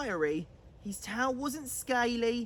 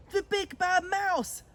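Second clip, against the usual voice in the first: high, loud startled cry; child monster yelling in fright; frightened yell; 8 semitones higher, 4 dB louder than usual. Acted. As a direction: shriek it out in alarm. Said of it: "yelled the Gruffalo's child".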